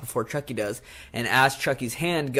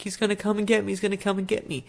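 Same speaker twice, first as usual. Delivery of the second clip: higher-pitched mock panic; lightly acted frightened kid; imitating a scared boy; 8 semitones higher, faster than usual. Voices panicked boy watching Chucky climb.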